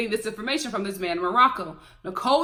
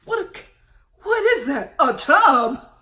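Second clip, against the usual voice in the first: lightly voiced mock-shocked husband, rising higher; mocking Russ; 4 semitones higher, 5 dB louder than usual. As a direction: imitate the husband gawking at the tub. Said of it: "looking all".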